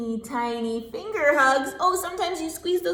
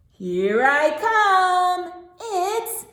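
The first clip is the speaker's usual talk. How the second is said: high, loud, slow excited call; excited big entrance; 7 semitones higher, 7 dB louder, slower than usual. Acted. Teacher calls out to the class, excited. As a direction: burst in as Daddy arriving.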